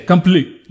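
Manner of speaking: complete with its final T dropped; poor swallowed version. Shown as a demonstration. Teacher criticising sloppy speech, complete with the ending eaten. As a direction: eat the end of complete. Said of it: "that's a poor word".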